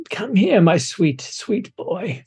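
put-on high crooning old hag. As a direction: coo it like an old grandmother. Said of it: "She goes".

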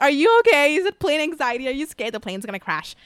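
a light impression of a worried stewardess; concerned air hostess. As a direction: check on a crying passenger with concern. Retelling the air hostess asking.

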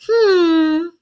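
high, loud put-upon "hmm" sigh; passive aggressive sigh. Retold or imitated. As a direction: sigh like a martyred mother-in-law. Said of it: "And then go".

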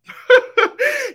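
a high, loud burst of real laughter; a squealing laugh that stops the story. Real, not acted. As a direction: break up laughing at the memory.

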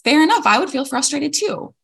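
chirpy, easygoing agreement; breezy mom reply. Retold or imitated. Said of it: "And I'm like".

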